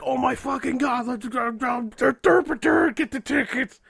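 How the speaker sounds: high, loud gushing excitement; over-excited friend. Retold or imitated. Coworker thrilled about the convention tickets, high and loud. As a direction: burst with giddy glee. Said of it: "And she's like".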